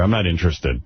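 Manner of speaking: ordinary delivery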